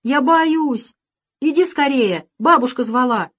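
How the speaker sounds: put-on high loud scared child's plea; frightened girl. A narrator's reading. Little girl begs Sasha to come back, frightened. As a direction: sound scared and small.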